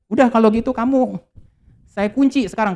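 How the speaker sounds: husband locking up his wife, mock angry outburst. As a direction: snap it out like a fed-up husband. Husband locks wife in angrily.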